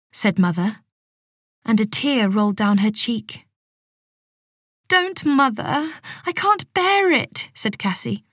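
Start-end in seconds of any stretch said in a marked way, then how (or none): 4.9-7.5 high, slow, pleading. A narrator's reading. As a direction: beg her to stop, voice raised. Cassie upset by mother's tears.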